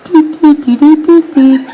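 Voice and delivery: high, loud beeping like a communicator; mock beeping. Imitating the fans' high beep at him. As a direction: beep it out like the morpher. Fans beeping Power Ranger sounds.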